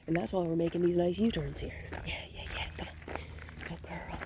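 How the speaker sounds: soft unvoiced whisper; wildlife watching whisper. Real, not acted. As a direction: hush it so the dog stays calm. Whispering while walking the dog.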